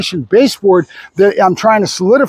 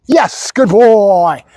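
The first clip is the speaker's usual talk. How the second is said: slow drawn-out praise; much slower than usual. Real, not acted. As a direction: draw each word out for the dog.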